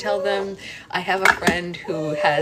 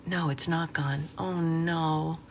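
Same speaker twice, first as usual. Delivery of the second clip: ordinary talk, nothing marked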